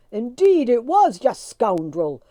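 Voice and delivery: loud, slow, bitter reply; angry retort. A narrator's reading. Tim angrily answering the leader. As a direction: spit it back at him. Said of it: "answered Tim".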